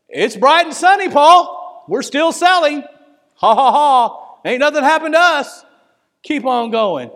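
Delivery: carefree ship's captain. Acted. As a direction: laugh off the warning with breezy bravado. Acting carefree captain mocking Paul's warning.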